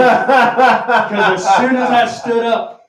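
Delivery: laughing through the words; laughing while telling. Real, not acted. Laughing as he tells his friend about the drink. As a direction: crack up telling how it hit you.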